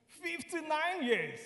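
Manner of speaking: high, loud and drawn out; impressed announcement. Real, not acted. Preacher announcing a couple's long marriage to the congregation, impressed. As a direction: proclaim it to the whole congregation.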